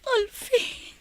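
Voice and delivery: high, soft, sobbing plea of his name; tearful soft call; crying. Acted. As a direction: cry his name as he walks away. Lover leaving her.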